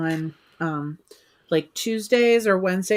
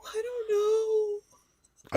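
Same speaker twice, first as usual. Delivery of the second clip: mock crying; 13 semitones higher, 6 dB quieter, much slower than usual. Mock despair over percentages.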